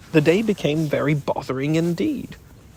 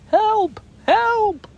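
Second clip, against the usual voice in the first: calling for help; 4 dB louder than usual. Acted. Piglet calling from the pit.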